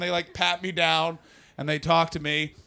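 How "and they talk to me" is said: ordinary talk, nothing marked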